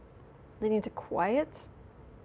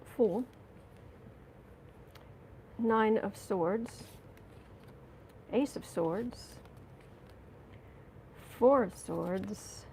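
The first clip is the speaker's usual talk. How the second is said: slow card reading; 3 dB quieter, slower than usual. Real, not acted. Reading cards slowly.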